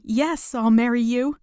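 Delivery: bride-to-be answering her proposal, elated; joyful yes. Acted. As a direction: let the yes spill out with joy. Accepting a marriage proposal.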